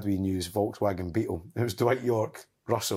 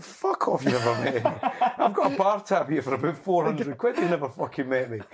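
raised, higher voice, German accent-free mock yell; mock shout; 6 semitones higher, 3 dB louder than usual. Telling the podcast host how he yelled at the player. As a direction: yell it back at him, half laughing. Retold row with footballer.

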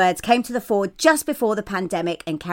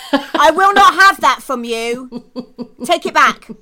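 mock stern; 4 semitones higher, 5 dB louder than usual. Mock scolding co-host, laughing.

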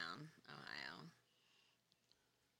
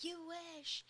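much higher, mocking "You wish"; sassy comeback; 13 semitones higher, 6 dB quieter than usual. To the man in her spot, a high sassy jab. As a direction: toss it back with sass, high and snippy. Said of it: "I was like".

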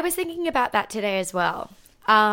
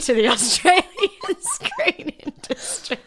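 laughing; 5 semitones higher than usual. Real, not acted. Mock grand announcement, laughing.

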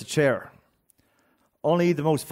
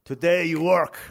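a blunt, louder order from Sinka, the guard; guard's order; 5 semitones higher, 5 dB louder, slower than usual. Retold or imitated. Guard telling the prisoner he works today, blunt order. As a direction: bark it as a flat command. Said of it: "And Sinka goes".

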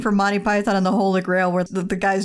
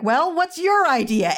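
slow, higher, drawn-out witchy challenge; mock witch retort; 7 semitones higher than usual. The witch challenges the villagers, slow and high. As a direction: lean into it like a smug witch. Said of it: "playing the witch as, like".

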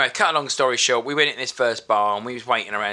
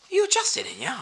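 lightly voiced business owner, pitched up; a hint of the old boss recognising him; business owner imitation. Retelling the business owner greeting him, lightly voiced. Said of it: "I went".

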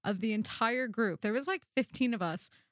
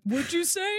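mock menacing challenge; 8 semitones higher, 5 dB louder than usual. Voicing the rude girl.

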